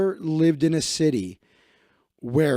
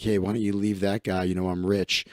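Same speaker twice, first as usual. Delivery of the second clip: lower, softer smug pickup line; oily rich creep trying to steal a girlfriend; smug rich guy; 10 semitones lower, 6 dB quieter than usual. Retold or imitated. Rich LA guy hitting on a girlfriend, lower and softer smug. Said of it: "always the same shit".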